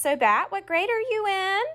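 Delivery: high, sing-song dentist voice; a cheerful dentist quizzing a patient with a full mouth; chatty dentist. Retold or imitated.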